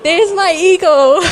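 slow, high, loud and laughing; joyful laughing. Retold or imitated. Mother recognising God's eagle, laughing loudly. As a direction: burst out with delighted laughter.

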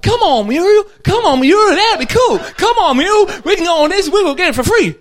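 high, loud, shouted begging; old husband begging his wife to take the free ride; excited pleading. Acted. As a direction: plead like a kid who wants a ride. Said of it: "And Fred's like".

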